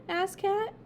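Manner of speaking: ordinary delivery, nothing marked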